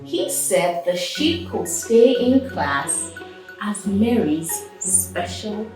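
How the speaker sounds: usual speaking voice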